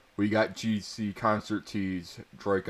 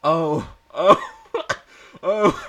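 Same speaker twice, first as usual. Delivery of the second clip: loud, much higher mock cries; giggly mock screaming; hammed-up scream; 9 semitones higher, 10 dB louder, slower than usual. A narrator's reading. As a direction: overplay the moans for laughs. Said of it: "I screamed".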